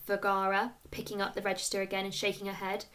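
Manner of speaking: usual speaking voice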